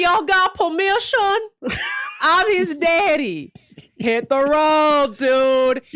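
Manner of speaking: slow drawl while amused. Retold or imitated. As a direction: replay his best lines. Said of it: "quotes the guy again".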